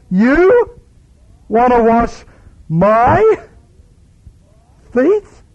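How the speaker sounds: high, loud shocked question; incredulous protest. Acted. Peter questioning God's right to serve him, shocked loud question. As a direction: rise on feet in disbelief.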